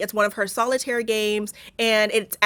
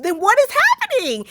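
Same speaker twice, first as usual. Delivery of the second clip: much higher, louder shocked exclamation; shocked shout; 6 dB louder than usual. Retold or imitated. Recalling a first viewing's reveal, a high, loud shocked cry. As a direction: cry it out in disbelief at the twist. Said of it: "I said".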